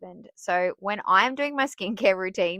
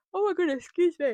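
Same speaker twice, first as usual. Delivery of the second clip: yawning; 11 semitones higher, faster than usual. Real, not acted. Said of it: "Here I go again".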